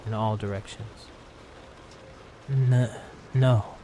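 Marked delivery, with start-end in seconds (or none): 2.5-3.8 stuttering. Acted. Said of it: "I stutter in reply".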